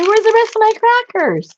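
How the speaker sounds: mock dismay. Real, not acted. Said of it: "did I eat all my crackers".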